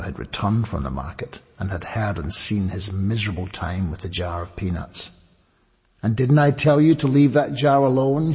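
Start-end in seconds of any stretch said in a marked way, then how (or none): 6.0-8.3 louder, higher scolding mother; a firm telling-off; admonishing. A narrator's reading. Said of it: "she admonished him".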